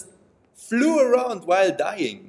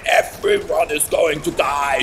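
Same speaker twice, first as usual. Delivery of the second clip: lower, still shouted repeat; mock villain shout; 3 semitones lower, 3 dB louder than usual. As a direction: growl the doom again.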